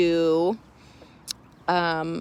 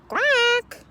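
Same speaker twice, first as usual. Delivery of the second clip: sudden high quack; one shrill call from inside; duck quack. Acted. Duck calling from inside the doghouse, high quack.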